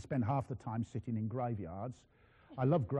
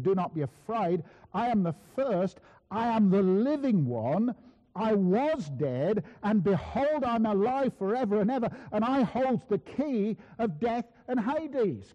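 loud, slow proclamation, rising higher; full-force preaching; 8 semitones higher, 8 dB louder, slower than usual. Acted. Christ speaking to John fallen at his feet, forceful proclamation. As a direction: declaim scripture with mounting authority.

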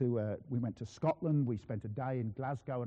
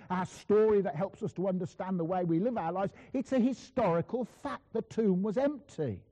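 higher, forceful pulpit proclamation; emphatic preaching; 8 semitones higher, 5 dB louder than usual. Real, not acted. Preacher affirming to Christians that Jesus is risen, emphatic preaching. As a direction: drive each point home as fact.

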